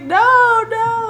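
child's mock denial. Mom imitating her son questioned about a slap, high insistent denial. Child denies it again, retold.

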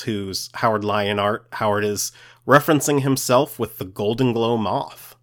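normal talking voice

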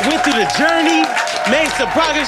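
squealing yay yay celebration; hype cheering. Real, not acted. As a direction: cheer him on like a crowd going wild. Cheering a friend's progress.

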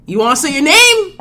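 loud high hype call; pumped-up hyped repeat. Real, not acted. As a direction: shout it to get him going.

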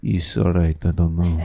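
put-on low, glum Russian accent; mock Russian accent, weary and low. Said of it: "it's layered in the accent".

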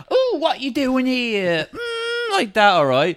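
high, slow camp greeting; hammed-up high camp man. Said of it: "They're like".

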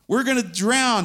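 high, loud, terrified plea; panicked cry for help. Acted. Disciples crying to Jesus in the storm, panicked shout. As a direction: yell it like you're sinking.

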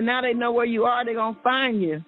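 normal talking voice

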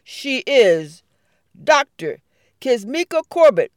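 loud, proud announcement. Acted. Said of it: "in a loud, proud voice".

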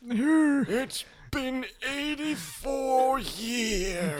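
high, very slow, hoarse little alien voice. Pretend. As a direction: drag it out like a tiny alien.

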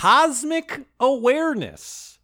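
high, slow mystical proclamation; hammed-up invocation. Host invokes Duffy's magic power, hammed-up high proclamation. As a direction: intone it like a grand magic power.

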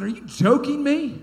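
high, loud, slow incredulous demand; righteous indignation. Retold or imitated. Young pastor challenging a council member, righteous indignation. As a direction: re-enact being loudly appalled, drawing it out. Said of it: "righteous indignation".

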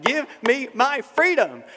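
slow, high, loud declaration; impassioned, without accent. Acted. Preacher insisting he believes in freedom, loud and impassioned. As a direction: cry it out high, word by word. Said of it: "I don't have to say the accent".

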